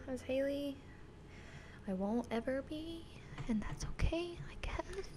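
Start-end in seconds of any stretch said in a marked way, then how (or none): 1.9-5.1 whispered, higher and wistful. Real, not acted. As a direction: breathe it out, resigned. Wistful self-deprecating aside.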